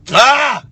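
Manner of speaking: high, loud cry of joy; joyful shout. Real, not acted. Worship leader shouting for joy in praise. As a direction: let out a burst of praise.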